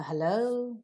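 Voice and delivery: HELLO lifted at the end; HELLO as a polite opening, pitch going up; rising greeting. Shown as a demonstration. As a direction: say hello with a questioning rise. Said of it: "rising tone also is given to the greetings".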